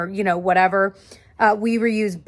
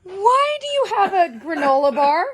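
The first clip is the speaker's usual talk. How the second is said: laughing at the dog; 12 semitones higher, 4 dB louder than usual. Real, not acted. Scolding dog while laughing.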